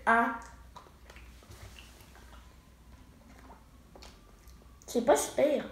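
sucking a hard candy; talking around a sweet; candy in mouth. Real, not acted. Seen 0: Girl put candy in mouth at -7; mouth closed, hand near it.

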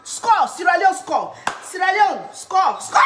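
high, loud, slow goal shout; goal cheer. Real, not acted. Cheering her team's goal at the TV, a loud, slow shout. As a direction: celebrate the goal at the top of your voice.

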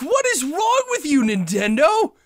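high, loud tearful protest with the stress on what; crying out at the game makers, stressing what; shouting through real tears; teary shout. Real, not acted. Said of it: "I'm tearing up".

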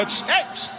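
whispered "ch" hisses to get attention; mock old lady hissing; hissing shush. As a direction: hiss at someone to call them over. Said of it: "chistándome".

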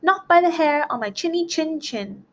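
high defiant call; shouted defiance. A narrator's reading. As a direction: taunt the wolf from safety. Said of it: "he shouted back".

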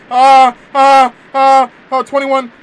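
high loud flustered cries; panicked stammer. Real, not acted. Gamer panicking on a timed quiz question. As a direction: blurt the answer in panic.

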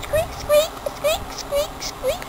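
high, soft squeaking; ducky squeaks. Pretend. Rubber ducky squeaking as it flies.

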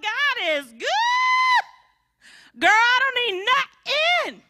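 high, slow, loud boastful sass; smug testimony. Pretend. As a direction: show off a carefree blessed life. Mocking smug blessed churchgoer.

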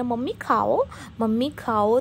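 mimicking her little daughter's sweet coaxing; mother lightly imitating her toddler urging "mummy eat"; loving toddler voice. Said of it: "इतने प्यार से बोलती है ना".

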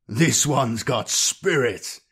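slow, lightly voiced villain amused by a captive; amused tyrant. A narrator's reading. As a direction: draw it out with relish as a tyrant. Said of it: "Blackheart laughed".

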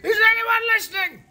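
loud, high fed-up yell; shouting to be heard; exasperated shout. Acted. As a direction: demand attention from everyone. Fed-up creature yelling at everyone.

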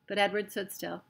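ordinary delivery

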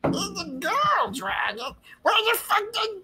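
very high, incredulous squawking; high-pitched squeal. Pretend. Mock-shocked squealed reply to joke.

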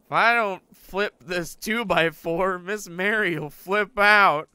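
loud, slow, frantic; frantic, dragging the words out. Real, not acted. Frantic about Miss Mary.

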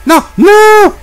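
very high, loud no no; real panicked shriek; panicked scream. Real, not acted. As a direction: cry out in horror. Panic as dog jumps.

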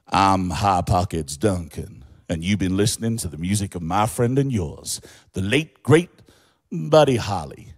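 Lubbock accent. Shown as a demonstration. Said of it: "in a supposedly Lubbock accent a voiceover".

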